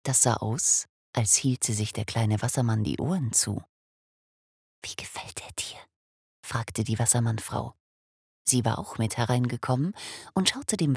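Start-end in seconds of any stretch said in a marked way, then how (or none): 4.8-5.8 hushed, much softer whisper. Acted. Wassermannfrau asking her husband about the baby, whispering. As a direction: whisper so the baby stays asleep.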